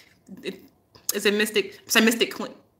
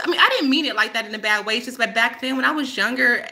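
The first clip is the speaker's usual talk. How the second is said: normal talking voice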